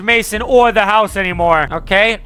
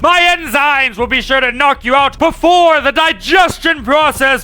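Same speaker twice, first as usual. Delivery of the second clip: slow, gloating creature host; sinister gloating monster; monster house voice; 3 semitones higher than usual. Acted. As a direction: purr it like a predator about to eat.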